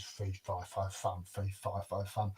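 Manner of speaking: low, quiet rumbled chant; soft, low ogre's refrain; brooding giant. A narrator's reading. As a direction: mull it over under your breath as a giant. Giant mulls over the request.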